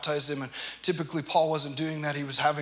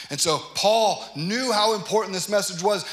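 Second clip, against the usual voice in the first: normal talking voice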